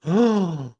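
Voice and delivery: high, loud-edged gasped "oh"; mock crowd gasp. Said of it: "the whole gasp".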